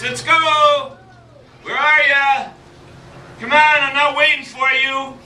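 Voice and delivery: father calling his son to go fishing, loudly. Acted. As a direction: call him from the porch. Father calls son from porch.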